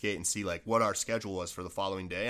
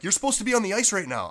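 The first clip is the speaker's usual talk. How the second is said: retold urgent telling-off; 9 semitones higher, 6 dB louder than usual. Teammates tell him he's late.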